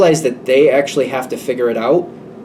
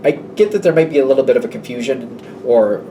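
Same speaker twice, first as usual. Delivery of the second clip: normal talking voice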